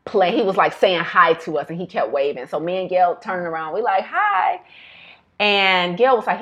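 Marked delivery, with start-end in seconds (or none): 4.0-4.6 sweet high greeting. Retold or imitated. Greeting the waving little boy.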